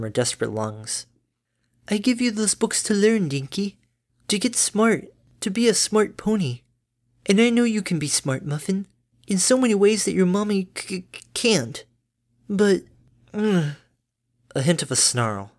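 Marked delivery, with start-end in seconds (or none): 1.9-13.7 high, bright-natured mother pony; doting mom, high and chirpy; cheery mother voice. Acted.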